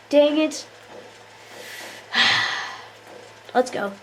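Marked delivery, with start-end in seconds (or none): 2.1-2.7 voiceless breathy sigh. Real, not acted. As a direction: blow out a short sigh. Sighs while playing.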